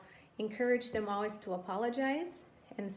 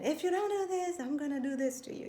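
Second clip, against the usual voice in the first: raised, faster threatening parent; mock threat; 7 semitones higher, 4 dB louder than usual. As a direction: play the threatening mom, pitched up and pushing. Mother voices a threat to child.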